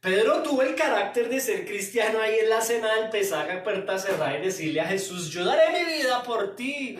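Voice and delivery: loud, driving sermon delivery; fiery preaching. Acted. Pastor preaching at full force to the church. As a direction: preach it hard to the congregation.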